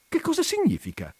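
very high, baffled question; ask, completely lost; bewildered. A narrator's reading. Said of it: "non riuscendo a raccapezzarsi". (sconcertata, voce molto acuta)

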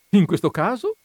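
high, loud and jolly; jovial exclamation. A narrator's reading. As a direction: burst in cheerfully, very pleased. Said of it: "esclamò giovialmente". (esclamazione gioviale)

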